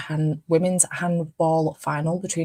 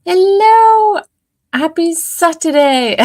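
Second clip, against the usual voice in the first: excited greeting; 13 semitones higher, 9 dB louder, slower than usual. Real, not acted. Cheery greeting to viewers.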